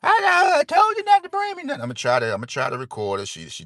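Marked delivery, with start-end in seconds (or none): 0.0-1.8 very high, loud mom voice, quick and scolding; mom's voice. Retold or imitated. Son imitates his mom refusing gifts, high and scolding. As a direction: play his mother fussing at him. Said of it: "see my mom".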